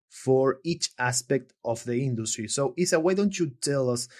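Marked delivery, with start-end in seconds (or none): none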